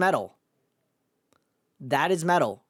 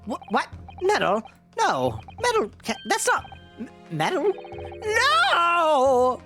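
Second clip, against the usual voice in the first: put-on high, frantic old professor; an old scientist losing his nerve, ending in a shout; panicked old man; 13 semitones higher than usual. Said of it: "the old man voice".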